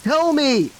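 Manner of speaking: high, loud frantic demand; demanding shout. Acted. Derek demanding answers from Josiah, high frantic shout. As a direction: yell it at him, desperate for answers. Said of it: "he demanded".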